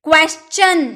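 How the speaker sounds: क्वेश्चन with "ch" after the s, kwes-chun; क्वेश्चन ending in a clear "chun"; correct CH pronunciation. Shown as a demonstration. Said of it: "क्या आवाज़ निकालेंगे".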